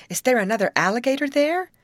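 high, loud worried question; anxious cry. A narrator's reading. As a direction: ask urgently, voice climbing. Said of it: "cried Molly".

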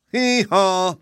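high, two-note hee-haw; high-pitched donkey-style hee-haw; mule bray. A narrator's reading. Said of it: "replied Hank".

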